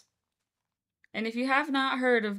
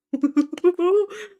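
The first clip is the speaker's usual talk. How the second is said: high laugh; 10 semitones higher, 14 dB louder than usual. Real, not acted. Greets new viewers.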